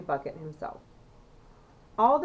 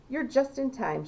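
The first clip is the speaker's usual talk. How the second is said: a higher, eager call; shouted; 6 semitones higher than usual. A narrator's reading. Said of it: "shouted Grandpa Joe".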